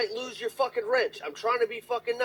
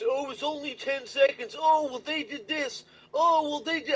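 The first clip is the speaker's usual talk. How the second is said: higher, slow whiny excuses; mock mod excuses; 6 semitones higher than usual. Mimicking the mod's excuses about timeouts, whiny. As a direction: whine like the mod making excuses. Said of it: "And then just".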